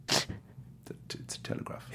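stammering, barely voiced whisper; nervous whisper. Starstruck extra answers the great actor in a frightened whisper. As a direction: whisper it, tripping on the first word out of nerves.

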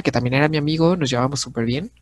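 usual speaking voice

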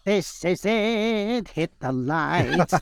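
high, very slow ghoulish drawl; Crypt Keeper voice. Acted. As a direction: stretch each word like a horror host. Doing the Crypt Keeper on air.

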